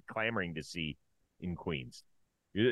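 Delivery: usual speaking voice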